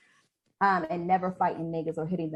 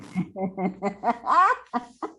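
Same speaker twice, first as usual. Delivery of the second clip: real amused laugh; 3 semitones higher, 6 dB louder than usual. Real, not acted. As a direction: burst of laughter, climbing high. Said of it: "I just laughed. It was funny".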